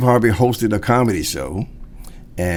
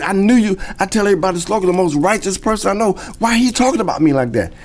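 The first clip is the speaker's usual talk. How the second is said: higher, fast, wounded friend; hurt Steve; 10 semitones higher, faster than usual. Retold or imitated. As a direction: pour it out fast and high, hurt and puzzled. Steve hurt, pleading, retold.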